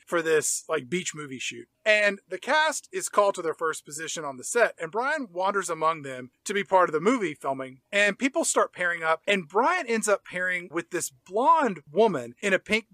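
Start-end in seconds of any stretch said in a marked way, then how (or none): none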